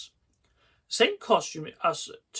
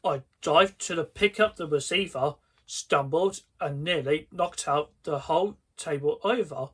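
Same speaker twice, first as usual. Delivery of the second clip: ordinary delivery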